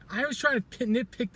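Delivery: stumbling over the word; tripping over the start of the word; stuttering. Real, not acted. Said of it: "I'm even stuttering a bit".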